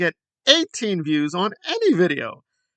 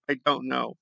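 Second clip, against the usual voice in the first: lower, softer resigned repeat; trailing off; 6 semitones lower, 7 dB quieter than usual. Real, not acted. Vlogger giving up on Trumper logic, lower and softer. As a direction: let it fade out in defeat.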